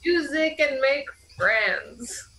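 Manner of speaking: slow lazy slacker impression; Guy. Player confirming the bard's voice, slow lazy drawl. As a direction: drag the words out idly. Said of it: "he goes like".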